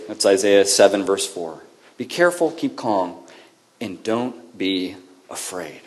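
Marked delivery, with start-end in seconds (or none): 2.0-5.8 soft repeat. Acted. Repeating verse softly.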